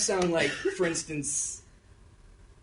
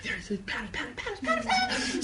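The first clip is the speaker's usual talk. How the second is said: mock frantic pattern-seeing, climbing higher; rising giddy obsession, softer; manic John Nash; 13 semitones higher, 6 dB quieter than usual. Obsessed mathematician seeing patterns everywhere, rising mock mania. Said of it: "You're like John Nash".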